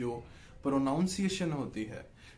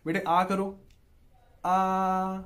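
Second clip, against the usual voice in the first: put-on higher, louder coaxing call to a child to open up; 5 semitones higher, 7 dB louder, much slower than usual. Parent coaxing child at mealtime, high and level. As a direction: coax a little one to say आ. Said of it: "बच्चे को खाना खिलाने लगे".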